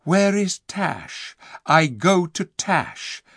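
slow, high, dazed calling of a name; man in a trance. Acted. The Calormene lost in a trance, repeating Tash's name slowly. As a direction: drift through it like a sleepwalker. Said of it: "He kept on saying".

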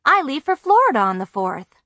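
eager, hopeful news; brightening. A narrator's reading. Girl eagerly points out she'll be in Florida.

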